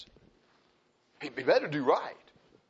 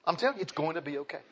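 ordinary talk

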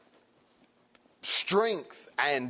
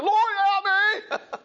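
cry for help; 6 dB louder than usual. Acted.

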